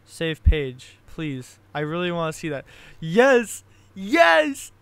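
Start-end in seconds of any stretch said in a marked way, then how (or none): 3.0-4.7 loud, high triumphant cry; excited yes. Real, not acted. Creator cheering his wiki edit, loud and high. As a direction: cheer at your own win.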